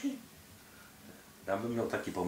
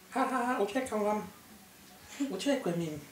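taunting high voice; 12 semitones higher than usual. Pretend. Spy taunts while escaping.

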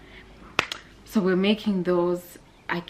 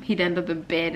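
ordinary delivery

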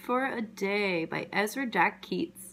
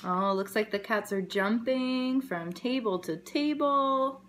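plain reading voice

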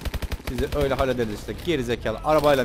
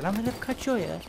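high joker voice; 9 semitones higher, 4 dB quieter, slower than usual. Acted.

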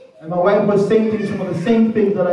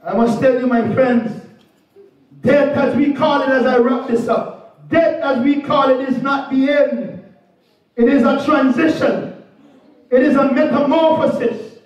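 rising, higher pulpit proclamation with hallelujahs; preaching; 4 semitones higher than usual. Acted. Preacher wrapping up a funeral sermon, rising proclamation. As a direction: lift the congregation, declare death is not the end.

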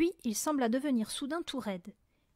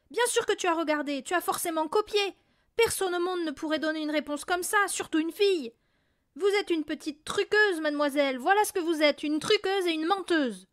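angry rant; 5 semitones higher, 5 dB louder than usual. A narrator's reading. Father ranting at Mathilda.

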